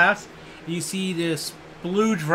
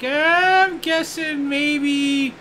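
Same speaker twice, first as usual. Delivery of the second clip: mock player guess; 7 semitones higher than usual. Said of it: "you're like".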